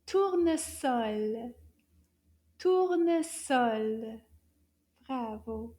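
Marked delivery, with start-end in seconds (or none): 0.1-4.2 slow model word. Shown as a demonstration. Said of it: "the French word for this flower".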